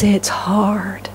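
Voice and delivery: whispered admission. Real, not acted. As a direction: breathe it out softly. Confiding to congregation.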